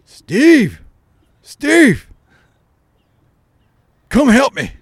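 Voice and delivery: high, loud mock plea of a frail old man; joking stepfather calling Steve from the bathroom; old man calling for help. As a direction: call out from the bathroom, playing helpless. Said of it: "he started going".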